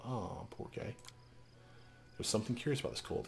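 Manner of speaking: ordinary talk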